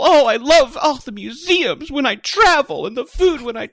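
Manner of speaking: high, loud swooning traveler voice; gushing traveler parody. Retold or imitated. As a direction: swoon over museums and food. Mocking a travel lover.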